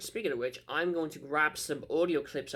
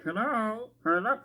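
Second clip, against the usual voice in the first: tin can phone; 7 semitones higher, slower than usual. Shown as a demonstration. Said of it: "It's like talking to a can".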